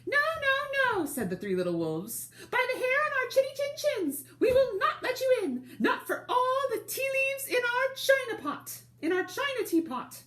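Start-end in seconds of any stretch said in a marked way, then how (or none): 0.1-1.1 very high, loud tiny wolf voices; squeaky little wolves. Acted. Little wolves refusing the pig through the door, very high and squeaky. 2.5-10.2 very high, loud tiny wolf voices; squeaky little wolves. Acted. Little wolves refusing the pig through the door, very high and squeaky.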